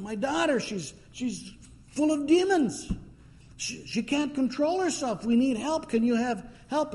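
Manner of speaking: high, pleading mother begging for help; desperate plea. Retold or imitated. Mother on her knees begging Jesus to heal her daughter, pleading. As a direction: beg high and urgent for a sick child.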